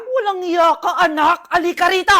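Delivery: put-on loud furious call to the child; angry scolding. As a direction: come here, you're in trouble. Mother turns on her son.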